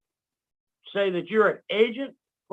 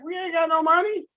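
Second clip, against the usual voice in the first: much higher, pleading we-got-nothing; high mock protest; 10 semitones higher than usual. Answering the corporation yelling down, mock protest. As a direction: answer the debt collector with a shrug. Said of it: "You're like".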